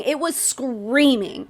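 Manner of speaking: screaming drawn out very slowly; slow emphatic. Real, not acted. Ranting at the mother, slow and emphatic. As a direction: lean on it was screaming, slowly. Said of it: "this infuriated".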